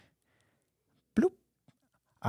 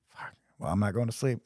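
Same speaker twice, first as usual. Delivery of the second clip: ordinary talk, nothing marked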